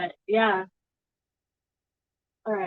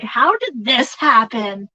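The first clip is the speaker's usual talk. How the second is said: slow, wondering, a bit louder; amazed disbelief; 4 dB louder, slower than usual. Retold or imitated. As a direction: marvel at your luck out loud. Amazed at finding his wife.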